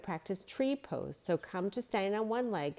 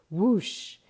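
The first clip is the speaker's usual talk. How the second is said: louder blown whoosh; wind whoosh; 8 dB louder than usual. A narrator's reading. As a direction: blow out like the wind. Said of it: "she blew out, making the sound of the wind".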